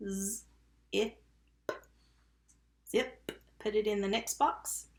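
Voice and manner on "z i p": "Z, I, p" as buzzed z, short vowel, popped p; sounded out. Shown as a demonstration. Teacher tapping zip with pupils, sounded out. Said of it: "Tap zip".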